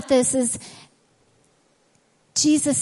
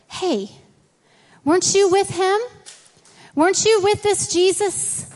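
higher, prying accuser; accusing bystander; 5 semitones higher than usual. Retold or imitated. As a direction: poke at him like a suspicious stranger. Accuser voiced in retelling.

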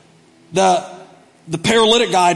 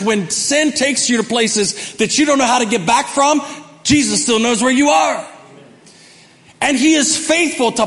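full-force preaching; 9 semitones higher than usual. Acted. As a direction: preach it hard to the church, pitch raised.

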